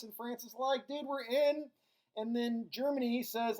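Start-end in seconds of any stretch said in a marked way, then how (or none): 0.9-1.7 high-pitched, playful buddy reply; eager mock agreement. France agreeing to back Russia, high playful eagerness. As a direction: jump in as the keen pal.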